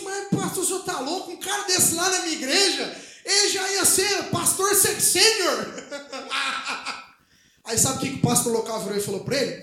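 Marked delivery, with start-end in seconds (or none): 0.0-5.7 amazed exclamation. Retold or imitated. Guest pastor amazed at usher. 5.7-7.0 short soft real chuckle; real laugh. Real, not acted. Preacher chuckling softly after the punchline, real laugh.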